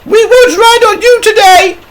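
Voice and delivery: very high, loud angry mother voice; outraged mum telling someone off; cross shout. A narrator's reading. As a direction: shout it crossly in a piercing falsetto. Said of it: "she shouted crossly".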